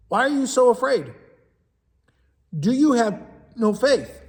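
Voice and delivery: Jesus to the disciples, mild questioning rebuke; Jesus rebuking. Acted. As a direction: ask it as Jesus chiding his frightened friends.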